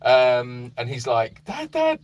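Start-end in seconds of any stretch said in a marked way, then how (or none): 1.4-2.0 very high, squeaky imitation of a frightened dog; excited, scared dog. Dog waking owner during a storm, excited and scared. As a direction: panicky pet voice, pitched way up. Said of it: "he was so excited and scared".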